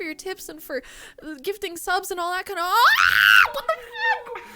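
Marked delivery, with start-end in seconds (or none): 2.6-3.5 loud, drawn-out shriek of shock; startled scream. Real, not acted. Streamer cut off mid-thanks by another gift, startled scream. Screams at gifted subs alert. 3.5-4.4 very high, loud, panicky disbelief; real overwhelmed outburst of refusal; shocked shrieking. Real, not acted. Streamer to viewers as gifted subs flood in, shocked shrieking. Stunned by more gifts.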